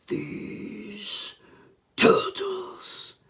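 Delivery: raspy whispered villain speech, slow; Shredder voice. Retold or imitated. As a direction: growl it out like the Shredder. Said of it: "Shredder voice".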